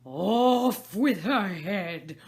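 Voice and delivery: loud, slow savage scream; furious screaming. Acted. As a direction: rage like a wild beast. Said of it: "like a wild beast, began screaming".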